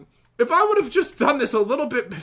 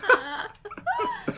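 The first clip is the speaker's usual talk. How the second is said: burst of laughter; 6 dB quieter than usual. Real, not acted. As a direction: laugh at your own failed stunt. Laughing at her failed plan.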